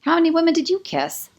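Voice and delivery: high, sharp indignant question. A narrator's reading. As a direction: throw the question back at him. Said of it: "my chest rising with indignation".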